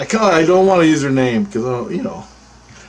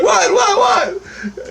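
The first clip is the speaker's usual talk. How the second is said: high, quick-repeated acted "what"; hammed-up startled response; alarmed reply; much slower than usual. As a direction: answer the yell in startled high bursts. Startled reply to boss's yell.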